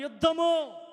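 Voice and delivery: high loud call of యుద్ధము; shouted response. Real, not acted. Preacher leading the congregation, loud shout. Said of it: "చెప్పండి, ఇప్పుడు చెప్పండి".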